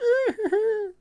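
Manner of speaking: put-on old man cackle.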